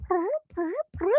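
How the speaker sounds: high, soft mock cat purr; imitated purring. Mother imitating the cat in her arms, high soft purr. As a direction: purr it like a contented kitten. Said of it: "She'll make this little sound".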